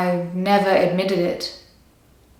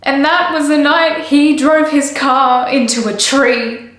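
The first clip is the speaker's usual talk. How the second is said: higher, louder, upset voice; distraught confession; 6 semitones higher, 5 dB louder than usual. Acted. Woman telling of his fatal crash, distraught confession. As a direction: force it out, pitch raised in distress.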